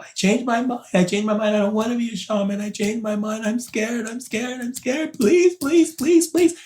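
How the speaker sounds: frightened voice breaking into a cry; panicked cry. Retold or imitated. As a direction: scream the plea in rising panic. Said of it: "I was screaming in my head".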